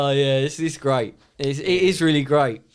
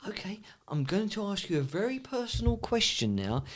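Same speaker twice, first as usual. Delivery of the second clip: whispered, softer setup; hushed boss; 5 semitones higher, 10 dB quieter than usual. Retold or imitated. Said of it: "He goes".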